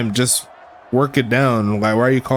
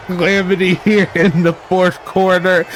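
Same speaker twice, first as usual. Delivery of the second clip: excited; 5 semitones higher than usual. Real, not acted. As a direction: belt the word calamity with excitement. Same phrase shouted in excitement.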